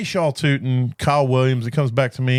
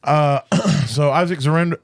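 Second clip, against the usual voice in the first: usual speaking voice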